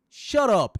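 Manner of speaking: loud snapping shut up, high; mock shout. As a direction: bark it out loud. Snaps at himself mid-demo.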